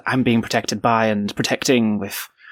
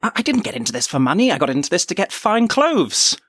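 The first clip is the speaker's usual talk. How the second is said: high-pitched, affronted objection; indignant protest; 8 semitones higher than usual. Acted. Clothes lover telling Rowena why they joined, indignant. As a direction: protest with wounded pride, pitch climbing. Wounded partner protesting in the scene.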